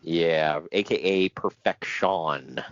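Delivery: slow, dry sarcastic drawl. Acted. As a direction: say it with heavy sarcasm. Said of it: "I don't know why you say that sarcastically".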